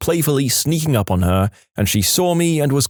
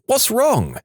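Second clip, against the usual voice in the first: alarmed mock shout; 12 semitones higher than usual. A narrator's reading. Wife startled by flailing husband, mock shouting. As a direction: call it out high in alarm. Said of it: "and I shouted".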